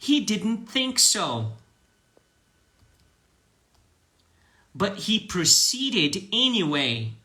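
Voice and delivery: slow, careful English lines; slow repeat-after-me. Shown as a demonstration. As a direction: read them slowly for students to echo. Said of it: "Vai repetindo".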